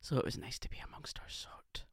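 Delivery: conspiratorial whisper; whispered aside. Real, not acted. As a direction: whisper it behind a hand.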